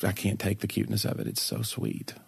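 whispered, low and soft; soft gushing whisper. Real, not acted. Host gushing about a gray-faced dog, hushed whisper.

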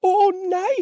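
very high drawn-out plea; pleading little goat. Acted. Littlest goat begging the troll, very high pleading. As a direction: squeal it in dismay, tiny voice. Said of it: "bad den lille bukke Bruse".

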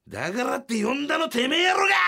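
high, loud furious shout; explosive rage. Retold or imitated. Partner snapping at the producer in the hallway, furious shout. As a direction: blow up at the producer. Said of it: "ぶち切れたのよ".

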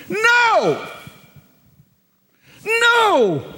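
high, far-reaching cry of No; the angel's sharp high refusal; emphatic no. Acted. Angel rebuking Jacob.

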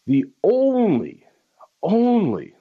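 "only" pitched high and stretched, then repeated; stress on only. Real, not acted. Talk host driving home a single reason, stress on "only". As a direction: hammer "only" twice, high and long. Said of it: "let me say it again".

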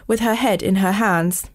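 ordinary delivery, nothing marked

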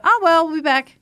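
bright, loud, breezy brush-off after a flop; cheery shrug-off. Retold or imitated. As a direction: toss it off like failing is no big deal. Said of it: "You go".

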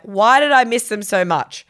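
loud, frustrated cry; exasperated cry. Retold or imitated. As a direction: throw up your hands and yell it. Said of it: "I'm like".

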